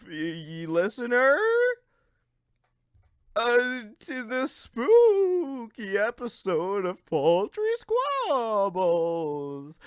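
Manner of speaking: slow, high ghostly moan. Acted. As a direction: drag it out like a ghost. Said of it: "spooky ghost voice".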